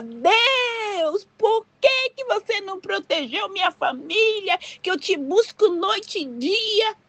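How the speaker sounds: high, drawn-out wailing plea; mock crying lament. Believer blaming God over her family, acted tearful wail. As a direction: wail reproachfully as if in tears. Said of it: "Eu ia chorar".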